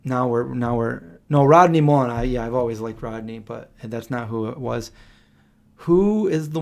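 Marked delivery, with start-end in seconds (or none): 1.3-2.1 excited. Real, not acted. Sudden recall of a name.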